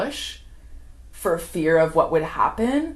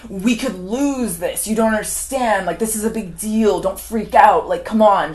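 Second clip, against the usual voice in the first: raised, lightly acted frustrated appeal; exasperated pleading; 4 semitones higher, 5 dB louder than usual. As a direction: urge them to cooperate, imitating him. Acting out husband's exasperated plea.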